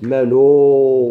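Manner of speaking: منو sung out with a long, loud final u; drawn-out vowel. Shown as a demonstration. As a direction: hold the last vowel of منو long to show lengthening. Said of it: "شنو عند الإشباع".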